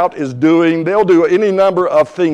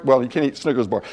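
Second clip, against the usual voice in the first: ordinary delivery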